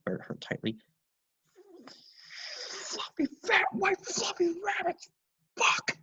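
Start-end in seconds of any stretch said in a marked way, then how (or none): 2.7-6.0 high whispered slow whimper. Acted. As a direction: whimper through the pain. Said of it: "the father whimpered out".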